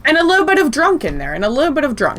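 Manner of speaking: high, sing-song Newfie imitation; Newfoundland accent. Friend joking about how Newfies talk, mock Newfoundland accent. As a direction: lilt it like a tipsy Newfie. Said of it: "Newfies talk".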